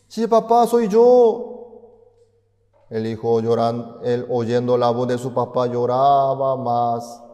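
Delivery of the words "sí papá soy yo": put-on very high, loud child's reply; a little boy calling back to his dad; child answering.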